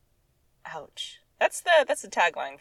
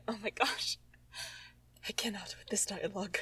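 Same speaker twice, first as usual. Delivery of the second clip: whisper; 11 dB quieter than usual. Real, not acted. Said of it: "whispered despair at dialogue".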